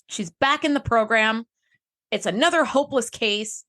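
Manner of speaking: ordinary delivery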